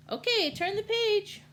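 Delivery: high, bright call to turn; cheery announcement. Acted. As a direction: sing it out to the kids. Reader prompts page turn.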